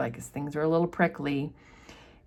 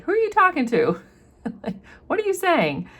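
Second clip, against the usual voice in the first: lightly acted indignation, pitched up high; mock affronted, much higher pitch; indignant challenge; 11 semitones higher than usual. Mother challenging her daughter's complaint, mock indignant.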